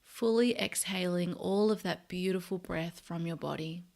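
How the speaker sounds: soft, unhurried meditation voice with long pauses; guided meditation. Real, not acted. Host leading listeners to notice body and breath, soft meditation voice. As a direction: guide them gently into body and breath, quietly.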